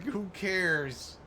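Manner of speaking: usual speaking voice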